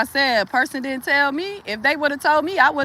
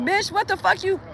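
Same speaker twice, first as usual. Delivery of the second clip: higher, snapping at an imagined rival; mock confrontation, pitched up; confrontational quote; 8 semitones higher, 3 dB quieter than usual. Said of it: "I mean, like".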